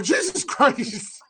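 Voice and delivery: high, drawn-out retold shock; shocked exclamation. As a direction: re-enact a startled outburst, stretched out. Retells shock at the video.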